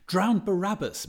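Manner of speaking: loud, high outburst; crying out. Acted. Daedalus cuts in with a loud, high cry. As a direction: bark it out, scandalized. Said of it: "Mister Daedalus cried".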